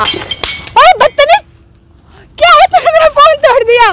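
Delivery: high-pitched indignant outburst; outraged. Real, not acted. She confronts friend who broke her phone, outraged. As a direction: yell at him in shock, voice shooting up.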